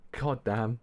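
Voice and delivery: usual speaking voice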